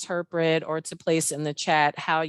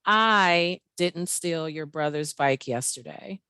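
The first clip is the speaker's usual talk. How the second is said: I leaned on, the rest even; stress on I. Shown as a demonstration. Host demonstrating the exercise to the group, stress on I. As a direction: punch the word I, then carry on evenly. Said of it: "with the emphasis on I".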